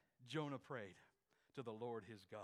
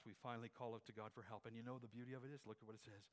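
ordinary delivery

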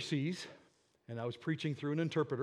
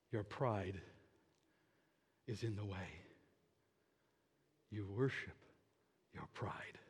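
low, soft and measured, with long pauses; hushed divine rebuke, sinking lower at the end; voice of the Lord; 6 semitones lower, 9 dB quieter than usual. Retold or imitated. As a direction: imitate God speaking quietly and gravely. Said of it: "the Lord's voice say to me".

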